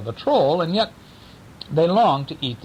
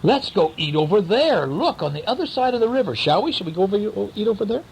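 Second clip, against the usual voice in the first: goat voices; 8 semitones higher, faster than usual. Acted. Goats talking in story.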